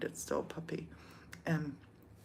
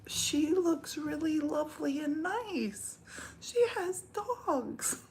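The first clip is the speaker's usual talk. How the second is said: much higher, slow, sugary mock praise; mock sweet voice; 10 semitones higher, slower than usual. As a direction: coo it in a syrupy fake-nice voice. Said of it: "So I click and I'm like".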